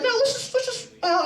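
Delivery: high, coaxing mock reporter voice; Chris Hansen. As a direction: imitate a host beckoning in a high singsong. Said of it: "And he's cool".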